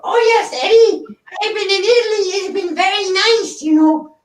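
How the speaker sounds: very high squeaky mouse puppet with a laugh in it; high puppet squeak, laughing through it; Topo Gigio voice. Retold or imitated. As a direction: chirp sweetly to Eddie like a little mouse. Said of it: "I can't do Topo Gigio".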